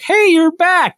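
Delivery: bright high hey you're back; cheerful greeting. Retold or imitated. Husband greeting wife coming home, high cheerful call.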